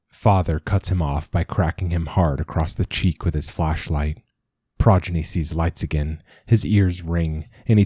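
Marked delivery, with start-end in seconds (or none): none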